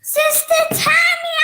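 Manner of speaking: high, loud, drawn-out squeal of the name; excited shouted greeting. Real, not acted. Child greeting a missed sister who joined, excited shout.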